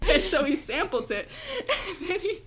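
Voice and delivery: ordinary talk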